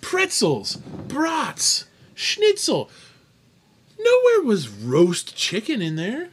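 high-pitched theatrical indignation; mock outraged disbelief. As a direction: list each one like damning evidence, high and aggrieved. Mock outrage at quiz answer.

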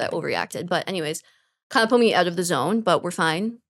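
normal talking voice

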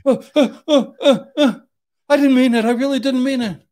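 high, loud, stammering plea; imitating a terrified man begging for his life; panicked pleading. Imitating the man he held at gunpoint, pleading in high frightened stammers.

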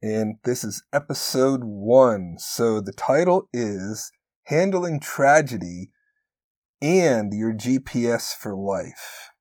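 slow, deliberate title read; slow announcement. Shown as a demonstration. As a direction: spell out the title slowly. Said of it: "So to say it again".

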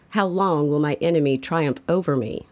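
ordinary delivery

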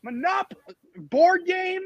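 high-pitched incredulous echo. Real, not acted. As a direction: repeat it in rising disbelief.

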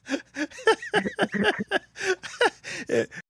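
long high-pitched laughing fit; laughter. Real, not acted. Host reacting to guest's passport story, laughing. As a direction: crack up at length, high and breathless.

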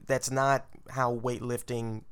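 usual speaking voice

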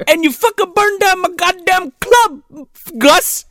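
high, loud mock rant; hammed-up high ranting father voice; Eddie Murphy's dad impression. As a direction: yell it like the barbecue-bit dad. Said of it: "he's talking like his dad, like, at the bar, in the barbecue bit".

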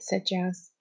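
ordinary delivery, nothing marked

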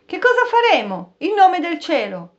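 grido alto e forte, disperato. A narrator's reading. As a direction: gridalo in preda alla disperazione. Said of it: "Harris gridò". (Harris grida all'uomo, disperato, con voce alta e forte)

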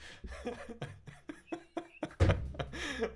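airy silent laugh; breathy laugh. Real, not acted. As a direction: wheeze with laughter. Laughing at the joke.

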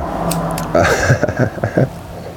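unvoiced wheezing laugh; breathy laugh. Real, not acted. Podcaster wheezes with laughter after the song. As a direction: laugh silently into the mic.

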